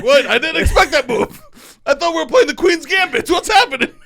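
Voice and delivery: very high, loud comic angel voice; mock-panicked angel. Comic voicing the angel at a chess game, mock panic. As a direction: squeal it as a hammed-up baffled angel. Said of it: "and the angel's like".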